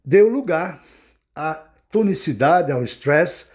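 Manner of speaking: ordinary delivery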